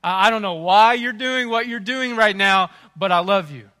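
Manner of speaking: a parent's loud, fond exasperation; exasperated loving shout. Retold or imitated. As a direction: bellow it with love behind it. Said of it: "God is looking at us sometimes and thinking".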